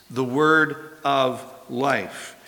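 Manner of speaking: The word of life read slowly, each word weighed; measured emphatic phrase; slow, weighted reading. Shown as a demonstration. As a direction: lean on "word" and draw the phrase out. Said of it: "life should be uppercase".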